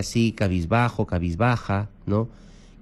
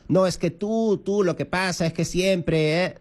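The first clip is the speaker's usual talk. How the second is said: higher, pointed accusing jab; mock accusation, pitched up; accusing retort; 8 semitones higher than usual. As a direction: imitate someone blaming the other person. Acted accusing reply in a quarrel.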